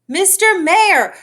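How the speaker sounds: high slow loud call. A narrator's reading. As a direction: call out urgently to someone far. Horton calling to mayor.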